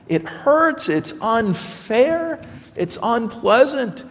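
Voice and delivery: ordinary talk, nothing marked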